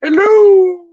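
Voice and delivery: loud high falsetto greeting; Mrs. Doubtfire. Retold or imitated. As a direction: sing out a long, piercing nanny hello. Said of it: "they always go".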